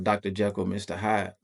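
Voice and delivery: usual speaking voice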